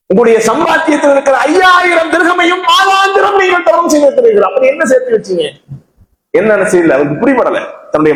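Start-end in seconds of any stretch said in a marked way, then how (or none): 0.1-5.4 high, sustained, incredulous challenge; insistent challenge. Acted. Friend challenging Salman over what he saved, insistent challenge. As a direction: argue it at a high pitch, building the case.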